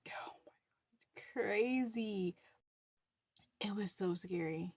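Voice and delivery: slow breathy hush; whispered awe; awed whisper. Real, not acted. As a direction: whisper the thrill back. Recalling thrill and fear.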